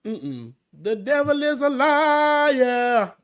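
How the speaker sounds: high, slow, defiant church declaration; mock-preachy slow proclamation; sassy declaration. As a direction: drawl it out like rebuking the devil. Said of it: "and I said".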